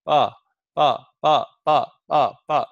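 "pa" with no breath on the fingers; unaspirated pa. Shown as a demonstration. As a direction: keep each "pa" dry, no puff. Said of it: "say pa".

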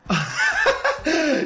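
loud, high, nervous laugh. Acted. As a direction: laugh it off in panic. Nervous laugh at kill order.

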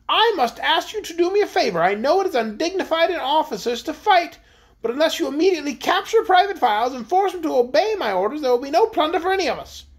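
high-pitched queen addressing her officers; imperious queen. A narrator's reading. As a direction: press them in a high, haughty royal voice.

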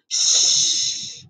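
unvoiced shush; shushing sign. Shown as a demonstration. Reading the sign to kids, a whispered shush.

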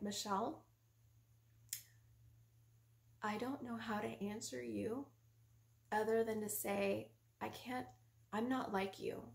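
soft, unhurried reply; calm husband. Retold or imitated. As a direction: answer an attack with quiet patience. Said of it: "He was so calm".

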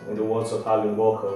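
ordinary talk, nothing marked

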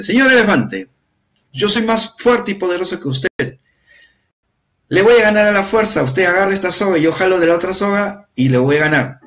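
hoch und lauter, prahlerische Schildkröte; prahlende Schildkröte. Acted. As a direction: fordere den Elefanten hoch und laut heraus. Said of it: "le dijo al señor elefante".

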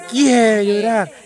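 slow, drawn-out mocking taunt; teasing taunt. Real, not acted. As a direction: stretch each taunt out to needle them. Teasing someone about to cry.

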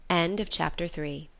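usual speaking voice

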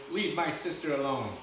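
ordinary delivery

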